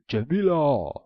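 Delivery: the ogre shouts for the runaway maiden; calling out. A narrator's reading. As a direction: shout for her across the castle. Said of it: "he rose and called".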